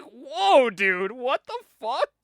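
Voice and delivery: high, drawn-out disbelief; mock shock. Host recalling reaction to a crude comic line, mock shock. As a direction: replay a stunned, incredulous reaction.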